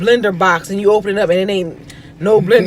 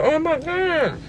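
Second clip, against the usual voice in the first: tearful quote; 8 semitones higher, 5 dB quieter, much slower than usual. Retold or imitated. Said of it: "I just started crying like".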